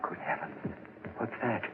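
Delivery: hushed, breathless dread; horrified whisper. Acted. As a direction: whisper it as if something unseen is there. Hears the throbbing, terrified.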